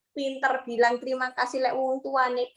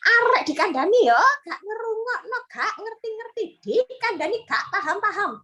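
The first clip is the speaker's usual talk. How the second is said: nagging parent; 8 semitones higher than usual. Retold or imitated.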